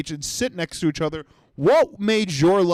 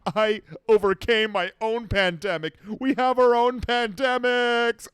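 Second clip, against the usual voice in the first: mocking self-righteous voice; 7 semitones higher than usual. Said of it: "It was not for you to be like".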